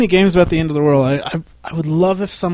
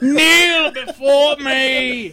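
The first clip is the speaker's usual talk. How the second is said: slow, higher, commanding villain; booming demon lord; 10 semitones higher, much slower than usual. Acted. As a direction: draw out the order like a tyrant. Quoting General Zod line.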